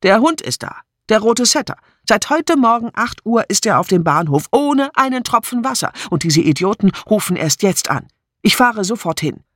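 high, agitated complaint; indignant outburst. A narrator's reading. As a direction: blurt out the news in outrage. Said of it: "Wütend schmiss sie den Hörer". (aufgebracht und empört)

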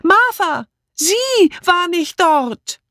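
high, drawn-out shout; calling after her. A narrator's reading. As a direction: stretch each word so it carries to the car. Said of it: "Amy rief hinterher". (langgezogen hinterhergerufen)

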